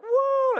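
very high, loud "woo"; cold-shock whoop. Retold or imitated. Storyteller re-enacting an ice-cold shower hitting him, shocked whoop. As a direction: yelp as icy water hits you.

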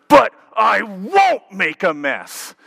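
put-on high, loud, slow toddler shout; venomous toddler. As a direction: shout it with stiff arms like a defiant three-year-old. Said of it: "with all of his venom".

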